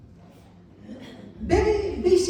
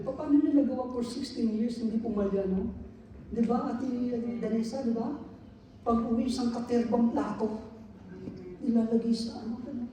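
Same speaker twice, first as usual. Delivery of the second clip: ordinary talk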